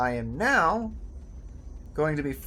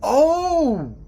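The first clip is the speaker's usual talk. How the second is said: much higher and louder; sudden realisation; 6 semitones higher, 6 dB louder, much slower than usual. Real, not acted. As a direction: gasp of discovery. Realises the eclipse.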